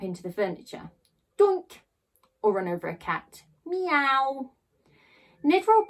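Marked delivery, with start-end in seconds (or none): 1.4-1.8 doink bump. A narrator's reading. Wheelchair bumps furniture. 3.7-4.5 cat meow. A narrator's reading. Cat run over.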